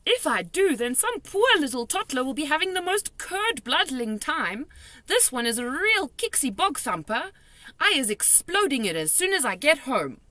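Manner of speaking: high, loud determined warning; grim warning. A narrator's reading. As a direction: warn of the danger. Giant warns of nightmare.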